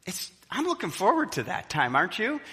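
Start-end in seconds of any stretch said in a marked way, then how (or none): none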